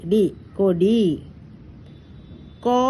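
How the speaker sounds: ordinary delivery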